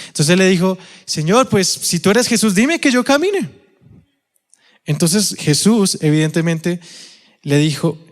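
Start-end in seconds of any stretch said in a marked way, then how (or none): 1.0-3.5 acted plea. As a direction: pitch climbing to a high finish. Acts Peter challenging Jesus. (súplica actuada que sube hasta el agudo)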